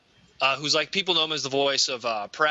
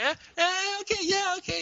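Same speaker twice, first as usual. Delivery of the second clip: much higher, strained smiling assent; forced polite agreement; 13 semitones higher than usual. Pretend. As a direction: chirp along through a fixed smile. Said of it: "They're just smiling the whole time".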